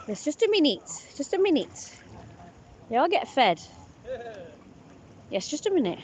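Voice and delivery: high, soft singsong coaxing; cooing to birds. Real, not acted. Talking to the birds waiting to be fed, high coaxing. Hushing impatient waterfowl during feeding.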